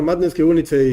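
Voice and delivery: normal talking voice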